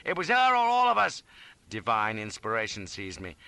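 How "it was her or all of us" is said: loud, high, emphatic yell; shouted justification. A narrator's reading. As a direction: shout it as the only choice. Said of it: "I shouted".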